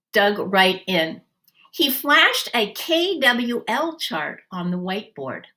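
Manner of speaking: plain reading voice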